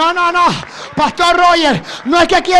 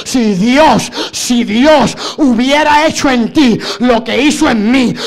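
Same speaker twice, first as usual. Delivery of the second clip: ordinary talk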